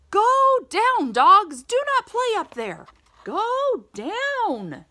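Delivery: high, loud scolding character. Acted. Reading to a child, a voice scolds the dogs on the roof. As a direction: tell them off from below, high and fast.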